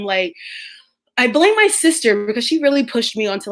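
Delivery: ordinary talk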